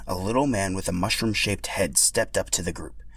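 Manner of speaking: ordinary delivery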